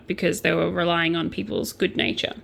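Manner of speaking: usual speaking voice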